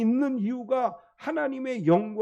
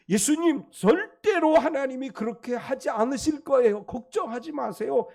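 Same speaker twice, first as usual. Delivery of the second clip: higher and louder, earnest pleading; Peter pleading; 5 semitones higher, 4 dB louder than usual. Retold or imitated. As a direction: beg him not to say it, urgently. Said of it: "이렇게 이야기하는 것이고".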